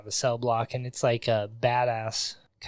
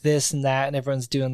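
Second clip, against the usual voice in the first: ordinary talk, nothing marked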